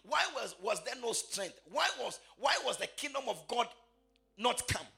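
high, ringing, half-sung preaching; preaching at full force. Acted. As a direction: preach it out to the congregation.

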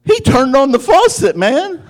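laughing exclamation of disbelief; amused disbelief. Retold or imitated. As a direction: marvel at the sudden tears. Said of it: "I was like".

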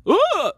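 very high, loud imitated gasp; sudden startled gasp. Imitating a woman woken from hypnosis, startled gasp. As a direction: jolt awake with a high, loud whoop.

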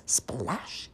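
soft, voiceless splash; whispery sound-word for a splash; splash effect. A narrator's reading. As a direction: let "splash" burst out in breath. Imitated splash in the story.

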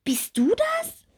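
high, slow, wondering question; amazed little bee. Acted. Bumblebee spotting her transformed friend, high and amazed. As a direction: ask in breathless wonder.

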